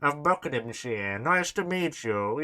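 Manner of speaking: hammed-up aristocratic British drawl; mock British aristocrat. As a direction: drop the jaw and play a pompous aristocrat. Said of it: "who's, like, a part of the aristocracy".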